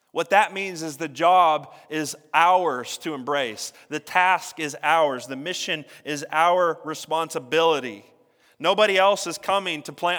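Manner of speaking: rising, forceful pulpit preaching. Acted. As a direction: preach it out to the congregation. Urgent call to the congregation.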